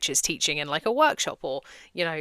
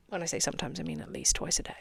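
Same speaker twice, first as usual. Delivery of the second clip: whisper; 8 dB quieter, faster than usual. Real, not acted. Confessional aside about frequency.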